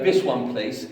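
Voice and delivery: ordinary talk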